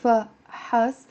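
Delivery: فحص slowly split into fa, has; drawn-out fa... has for فحص. Shown as a demonstration. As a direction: separate both syllables of فحص. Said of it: "with a a".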